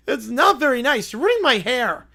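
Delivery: high, loud whining complaint; whiny kid. Acted. Whiny cartoon kid complains to the prank callers, loud whine. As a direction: whine like a pampered child.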